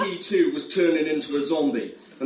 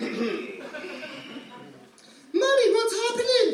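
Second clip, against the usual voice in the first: put-on frightened child; 12 semitones higher than usual. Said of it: "the kid cried".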